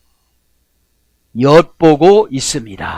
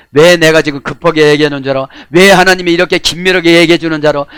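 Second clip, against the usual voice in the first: usual speaking voice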